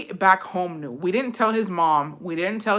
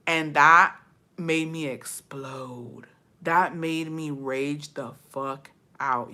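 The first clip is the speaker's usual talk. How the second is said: slow dramatic emphasis; 4 semitones lower, much slower than usual. Real, not acted. Telling listeners about the breaking point, slow and dramatic. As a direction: draw it out ominously, held back. Storyteller dramatically slows before outburst.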